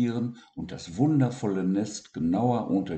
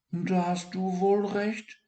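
sniffling; 11 semitones higher than usual. Acted. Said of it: "schniefte Siggi".

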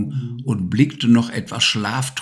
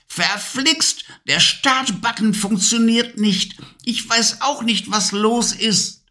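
angry witch; 12 semitones higher than usual. Acted. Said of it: "schrie wütend".

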